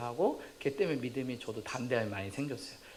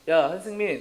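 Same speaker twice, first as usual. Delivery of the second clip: raised, higher voice calling a pupil; teacher calling out; 4 semitones higher, 7 dB louder than usual. Acted. Homeroom teacher picks out a student, calling out. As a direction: call sharply across the classroom.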